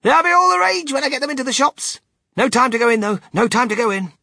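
high, loud, rushing salesman's boast; excited shouting. A narrator's reading. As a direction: crow it while hurrying on. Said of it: "shouted Mister Wonka".

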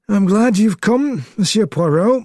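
lightly voiced, courteous welcome; polite. A narrator's reading. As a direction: greet a colleague courteously. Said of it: "he said politely".

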